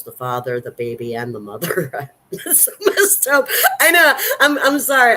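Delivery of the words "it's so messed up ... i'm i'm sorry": high, loud laughing through the words; laughing nervously at something awful. Real, not acted. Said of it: "This is nervous laughter".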